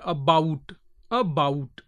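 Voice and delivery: "about" with bout leaned on; stress on bout. Shown as a demonstration. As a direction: demonstrate "about", second syllable stressed. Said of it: "bout is given a little more stress".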